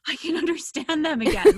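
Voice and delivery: retold burst of delight, high and loud; excited realization. Traveler recalls suddenly understanding people in London, excited. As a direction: blurt it out high and loud, amazed.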